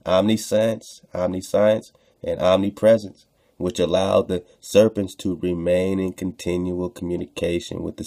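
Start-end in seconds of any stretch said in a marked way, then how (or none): none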